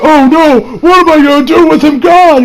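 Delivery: high, loud mock panic; panicked fighter. Fighter losing his partner, high mock panic. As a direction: whine it like you're out of options. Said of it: "the dude's like".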